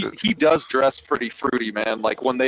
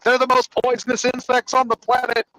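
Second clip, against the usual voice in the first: indignant villain; 8 semitones higher than usual. Retold or imitated. Villain defends his butterfly theme.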